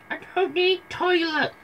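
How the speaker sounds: very high fake granny falsetto; wolf hastily putting on Granny's high voice; wolf faking Granny. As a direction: correct yourself into a squeaky granny voice.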